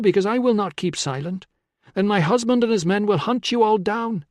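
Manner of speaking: high, insistent warning; urgent pleading. A narrator's reading. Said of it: "she urged".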